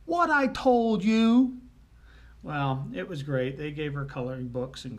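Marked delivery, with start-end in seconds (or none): 0.1-1.6 high, loud, drawn-out telling-off; scolding little girl. Retold or imitated. Daughter with hands on hips scolds the culprit, loud and slow. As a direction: slowly rub it in, loud and high. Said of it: "Becky puts her hands on her hips".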